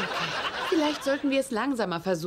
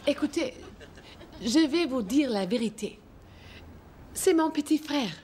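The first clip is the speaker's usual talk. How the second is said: put-on French accent. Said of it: "Regine Philange".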